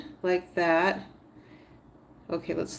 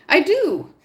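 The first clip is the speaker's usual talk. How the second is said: loud, high burst of triumph; real happy outburst, louder and higher; delighted; 10 semitones higher, 8 dB louder than usual. Real, not acted. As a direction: cheer it out, it worked. Count works out, triumphant.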